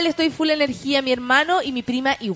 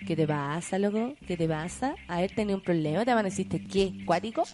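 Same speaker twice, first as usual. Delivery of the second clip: mock tough guy; 5 semitones lower, 12 dB quieter than usual. Mock-tough reply to rude tweet.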